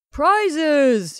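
high, loud announcing cry. A narrator's reading. Ringmaster atop the piano calls for silence, crying out. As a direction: cry it out to the whole room. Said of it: "he cried".

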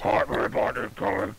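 hot robot voice. Pretend. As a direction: stiff mechanical robot voice. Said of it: "gonna do a hot robot voice".

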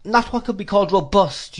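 put-on high, loud emphatic old mother; emphatic old lady. A narrator's reading. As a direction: lean hard on each word. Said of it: "said Missus Markleham with emphasis".

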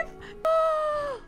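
高く息をのむ; a sharp, excited gasp at the view. Real, not acted. Excited at the new area.

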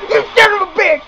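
high-pitched, exasperated rant; frustrated cursing. Real, not acted. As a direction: curse the villain who keeps beating you. Cursing Riddler after crash.

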